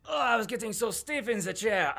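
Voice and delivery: high, put-on complaining voice; the Duke. The Duke freed from the chair, high complaining voice. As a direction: groan it out high, stiff from sitting. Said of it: "the Duke goes".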